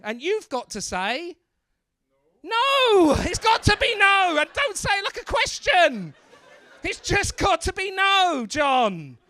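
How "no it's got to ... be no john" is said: high, loud exasperated correction; mock-outraged firm scolding; emphatic insistence. Shown as a demonstration. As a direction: shout it as the only acceptable answer. Demonstrates firm no, scolding John.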